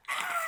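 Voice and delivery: comic imitation of a panicked scream; mock scream. Co-host imitates the character turning round in terror, mock scream. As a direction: let out a silly mock shriek. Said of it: "screams like a bitch".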